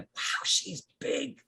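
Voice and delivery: very high, slow breathy awe; tiny awed voice. Acted. A tiny plant marvelling at the crouching gardener, high and hushed. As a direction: whisper up high in wonder. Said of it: "a little tiny voice from very close going".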